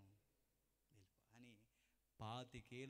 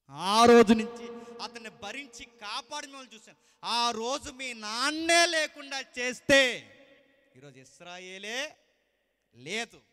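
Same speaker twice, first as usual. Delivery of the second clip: high, loud thundering sermon; fiery preaching; 11 semitones higher, 28 dB louder than usual. Acted. Preacher driving the point to his congregation, thundering. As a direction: hammer every line home to the church.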